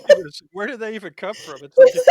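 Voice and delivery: loud, high words through laughter; laughing. Real, not acted. Laughing at the dream.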